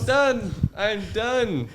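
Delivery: high, loud protest; mock-defeated outburst. Real, not acted. Comedian quitting accents after failing, loud mock outburst. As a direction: cry out in comic defeat.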